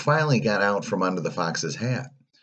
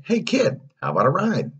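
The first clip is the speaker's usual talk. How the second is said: high, lively hail; pushy coachman touting a ride; coachman hustler; 13 semitones higher, 4 dB louder than usual. A narrator's reading. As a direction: call out an offer to a passing kid. Coachman luring the boy.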